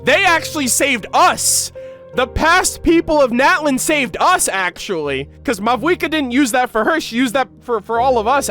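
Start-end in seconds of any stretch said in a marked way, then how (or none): none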